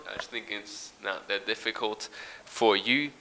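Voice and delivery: normal talking voice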